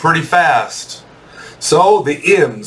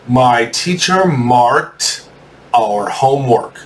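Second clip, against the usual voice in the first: slow, with a pause after marked; slow dictation; slower than usual. Shown as a demonstration. Teacher giving students the sentence to write, slow dictation. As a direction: dictate it slowly in two chunks.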